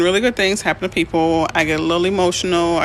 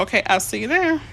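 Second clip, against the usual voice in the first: ordinary talk, nothing marked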